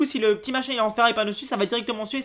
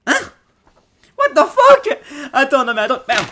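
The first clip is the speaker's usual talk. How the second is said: very high, loud startled outburst; real astonishment; 8 semitones higher, 12 dB louder than usual. Real, not acted. Unboxer startled by a magnetic gadget, astonished. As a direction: explode in high-pitched disbelief with a laugh in it.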